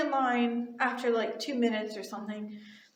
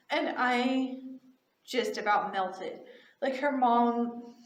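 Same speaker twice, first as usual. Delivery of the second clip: ordinary talk, nothing marked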